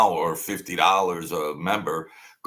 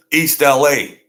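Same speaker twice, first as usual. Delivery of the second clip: mocking punchline; 6 semitones higher, 10 dB louder than usual. Real, not acted.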